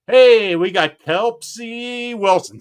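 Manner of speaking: loud, high, drawn-out announcement; exaggerated slow call, pitched well up; really slow. Shown as a demonstration. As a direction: stretch out each syllable of Kelpsy like a big introduction. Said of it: "that's how I can say it".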